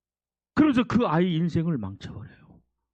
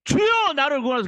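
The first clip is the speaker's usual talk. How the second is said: high loud plea to be saved; desperate plea; 8 semitones higher, 6 dB louder than usual. Acted. Peter crying to the Lord, high loud plea. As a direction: beg the Lord to save you.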